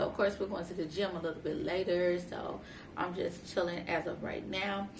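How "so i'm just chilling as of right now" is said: usual speaking voice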